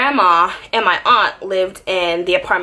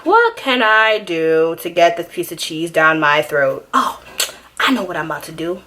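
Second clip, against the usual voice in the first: plotting inner voice; 4 semitones lower than usual. Retold or imitated. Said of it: "in my head, I'm plotting".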